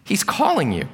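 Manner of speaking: high-pitched encouraging call. Acted. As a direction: call over to someone in the crowd. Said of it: "they called to the blind man".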